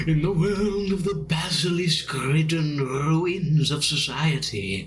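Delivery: slow, drawn-out spooky storyteller; mock-ominous narration. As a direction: intone it grandly, ending in a high ghostly ooh. Mock-ominous game world narration.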